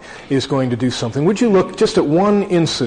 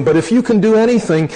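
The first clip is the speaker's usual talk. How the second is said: desperate plea; 7 semitones higher, 5 dB louder, faster than usual. Acted. Desperate father pleads to Jesus.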